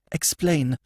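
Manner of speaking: a touch impatient, first demand; clipped impatient order. Acted. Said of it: "tapping the floor with her foot".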